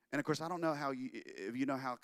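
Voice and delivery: usual speaking voice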